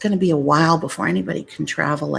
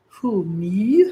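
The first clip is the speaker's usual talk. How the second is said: mock surprised waiter, higher and tiny; bashful waiter; 3 semitones higher than usual. Bashful waiter asked to sing happy birthday, mock surprised. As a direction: play the shy waiter caught off guard.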